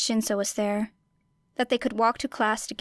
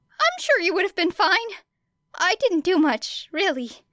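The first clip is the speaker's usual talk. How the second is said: put-on high, shy, self-effacing boy's voice; bashful hero brushing off praise; high, modest, nervous boy; 9 semitones higher, 3 dB louder than usual.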